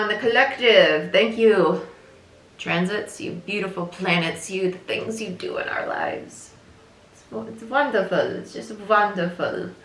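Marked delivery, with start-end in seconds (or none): 2.6-9.7 soft and tender. Real, not acted. Softly thanking the planets.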